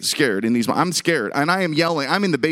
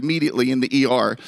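normal talking voice

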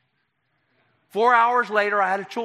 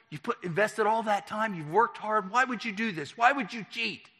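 high-pitched, pressing questions; wife's incredulous challenge, retold; disbelieving reproach; 6 semitones higher than usual. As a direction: plead with him, pitched well up. Wife confronting him over cheating.

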